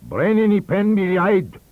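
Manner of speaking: high, loud scornful Welsh abuse; cruel abuse. A narrator's reading. Dr Evans berating the shepherd, a loud scornful shout. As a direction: bark cruel Welsh insults at a servant. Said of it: "the cruel words of abuse".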